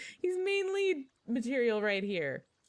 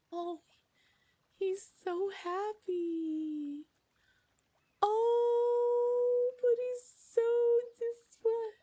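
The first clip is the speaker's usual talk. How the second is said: high, gushing squeal; excited squeal; 14 semitones higher, 5 dB louder, much slower than usual. Real, not acted. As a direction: swoon over him. Gushing over handsome villain.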